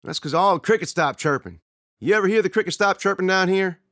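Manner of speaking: high, loud and urgent; tense argument. Acted. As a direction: press him to listen. Brothers arguing tensely.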